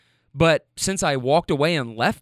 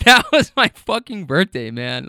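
raised exasperated voice; 2 semitones higher, 6 dB louder than usual. Real, not acted. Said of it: "I raise my voice a little bit, no more or less than I just did".

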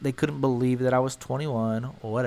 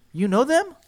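high, louder mocking question; high incredulous question; 11 semitones higher, 4 dB louder than usual. Bystanders quizzing the narrator, high incredulous. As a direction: ask it high in disbelief. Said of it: "they look at me and go".

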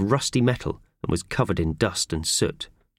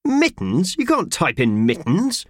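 outraged disbelief; 11 semitones higher, 4 dB louder, slower than usual. Acted. Boss outraged at mittens.

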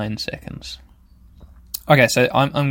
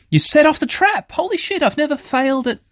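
shocked outburst. Real, not acted. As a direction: react in real disbelief. Trap triggered again.

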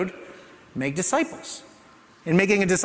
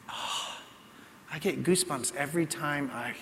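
ordinary delivery

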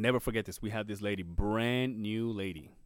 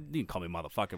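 ordinary talk, nothing marked

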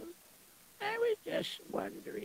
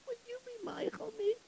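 higher, tearful plea; crying plea; 5 semitones higher than usual. Acted. Widow begging the shamas not to mind, crying. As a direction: beg through tears. Widow cannot pay the tip.

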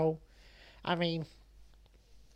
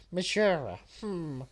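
ordinary talk, nothing marked

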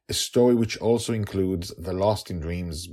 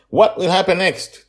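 high, loud cliffhanger question; dramatic tease; 11 semitones higher, 8 dB louder than usual. Acted. Podcaster teasing listeners with a loud high cliffhanger. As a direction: ham up the suspense like a serial announcer.

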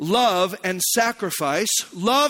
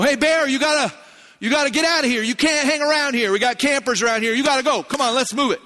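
higher, insistent call to a bear; puffed-up shooing; 5 semitones higher than usual. Retold or imitated. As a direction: act big and order the bear away. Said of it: "puff ourselves up and make ourselves seem".

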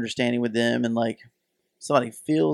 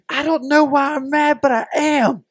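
high, loud, drawn-out imitation shriek; mock blood-curdling scream; 14 semitones higher, 8 dB louder, slower than usual. Imitating a mad woman in the driveway, mock screaming. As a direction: scream it out slowly, pitched way up, as her. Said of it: "this blood-curdling scream".

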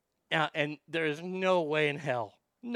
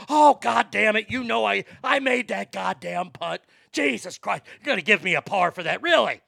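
loud, higher indignant ranting; dad griping at his son over the scorecard; imitating dad's complaint; 5 semitones higher, 8 dB louder than usual. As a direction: protest the unfair score loudly. Dad complains about the penalty.

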